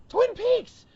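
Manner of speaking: very high, loud fan shout; excited outburst. Retold or imitated. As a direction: blurt it out like a madman. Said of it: "I'm spewing it out".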